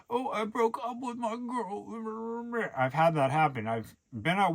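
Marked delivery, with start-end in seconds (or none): 0.1-2.5 high, slow, whining mock sob story; mock a self-pitying whine, way up high; hammed-up whimper of a dumped guy; whiny complainer. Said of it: "I coulda been complaining to everybody".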